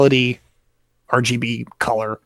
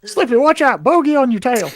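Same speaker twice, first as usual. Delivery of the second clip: Star Fox pilot voice; 11 semitones higher than usual. Retold or imitated. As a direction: yell it like a space-fighter wingman. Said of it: "I thought Slippy from Star Fox".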